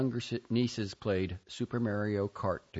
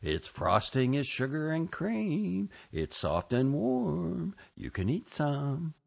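slow, higher, coaxing temptation; tempting inner voice; 4 semitones higher than usual. Retold or imitated. Bacteria voice tempting her toward the gingerbread, slow coaxing. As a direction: lure her in slowly like a sweet whisperer. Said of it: "say to me".

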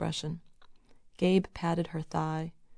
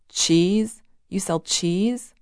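loud, scornful disbelief; incredulous; 7 dB louder, slower than usual. A narrator's reading. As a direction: question it with rising, disdainful astonishment. Bruce incredulous at Gabe's job.

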